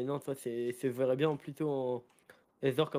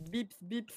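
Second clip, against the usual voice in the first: reversing beep imitation; 5 semitones higher, 5 dB quieter than usual. Backing-up beeper imitation.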